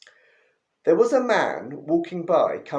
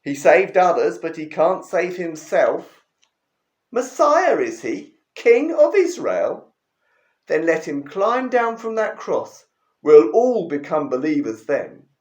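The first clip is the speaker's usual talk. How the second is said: mocking taunt; 6 dB louder than usual. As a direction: gloat and poke fun at him. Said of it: "having a great time poking fun at him".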